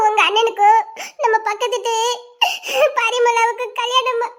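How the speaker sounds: slow, sobbing delivery; tearful, catching breath between phrases; crying. Acted. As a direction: break the news through sobs. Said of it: "நீ எதுக்கிட்ட அழுதுட்டு வர".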